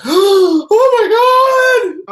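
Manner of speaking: very high, loud, drawn-out shriek; mock shocked. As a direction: shriek like a scandalized girlfriend. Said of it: "they're just like".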